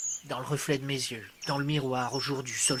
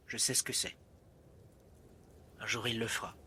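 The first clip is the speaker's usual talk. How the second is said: low, soft, knowing murmur; quiet, lowered repeat of a prophecy; hushed aside; 5 semitones lower, 13 dB quieter, faster than usual. Acted. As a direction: drop right down, as if confiding it.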